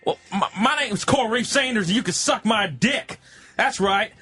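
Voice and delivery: Coral Reef Sanders. Acted. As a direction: belt it out like a crude good old boy. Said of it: "let's hear a little more Coral Reef Sanders".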